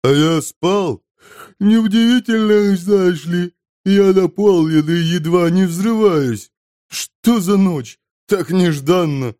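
high, loud character voice; bloated glutton. A narrator's reading. Glutton marvels to Inep on waking, high loud voice. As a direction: heave out the words, drawn-out in places.

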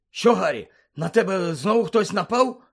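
high, groggy questioning; sleepy question. A narrator's reading. Seamus to Harry, woken by his cry, sleepy. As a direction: ask as if just woken. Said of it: "сонно спитав Шеймус".

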